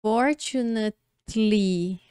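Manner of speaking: "Fortunately" slowly broken before a clear "tlee"; slow down and separate the end of "Fortunately"; ending shown slowly. Shown as a demonstration. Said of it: "olha, o finalzinho". ("Fortunately" devagar, destacando o final "tli")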